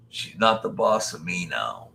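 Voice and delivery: ordinary talk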